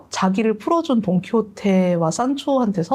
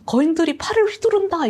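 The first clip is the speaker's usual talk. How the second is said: high, urgent cry; knight's alarm; 8 semitones higher than usual. Retold or imitated. Don Quixote warning Sancho about giants, high urgent cry.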